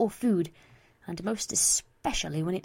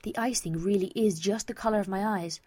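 usual speaking voice

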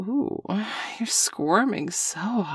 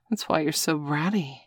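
normal talking voice

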